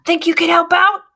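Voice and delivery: high, urgent ask; quick eager ask to his partner; boy to the rat. A narrator's reading. Boy asks his rat for help, high and eager. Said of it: "hisses the boy to the rat".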